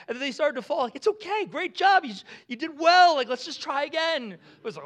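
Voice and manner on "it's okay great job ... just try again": high, loud pretend cheerleading; hammed-up, upbeat parental praise; encouraging parent. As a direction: cheer on a little kid who fell. Parent cheering on a fallen child.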